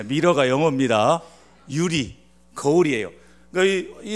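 ordinary delivery

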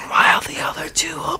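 whispering. Real, not acted. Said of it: "Are we whispering still?".

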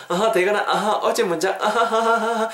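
mock awkward laughing dodge; sheepish student excuse. Student dodging the teacher's review, sheepish.

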